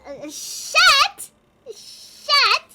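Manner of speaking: high, loud squawked shushing; playful high-pitched outburst; mock-outraged yell. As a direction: cut them off with a loud squawk. Mock outrage at partner's teasing question.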